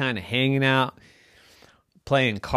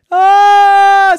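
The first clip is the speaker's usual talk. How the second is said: long, high, very loud scream; frightened scream; 18 dB louder than usual. Acted. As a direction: scream like a terrified mom. Mommy sees ghost on bed.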